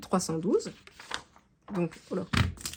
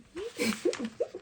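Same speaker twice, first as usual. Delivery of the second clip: embarrassed laugh; 10 semitones higher, 5 dB quieter than usual. Real, not acted. Laughs at missing sheet.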